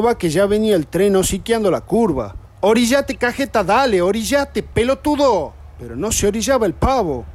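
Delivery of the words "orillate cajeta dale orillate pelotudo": yelling warning. Retold or imitated. Yelling at friend on tracks.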